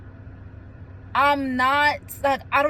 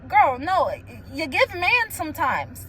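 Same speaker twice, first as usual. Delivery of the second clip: ordinary talk, nothing marked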